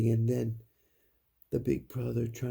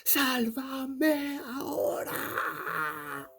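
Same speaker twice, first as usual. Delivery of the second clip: high, long, drawn-out wailing scream; ghost girl yelling; 14 semitones higher, much slower than usual. A narrator's reading. Storyteller as ghost girl demanding rescue, yelling out. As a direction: shriek it slowly as a ghost child. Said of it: "And she yelled out".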